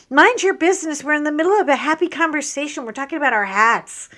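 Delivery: loud, fast retort; loud comeback. Retold or imitated. Telling the passerby to mind her business, loud. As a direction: tell her off loudly. Snapping back at woman.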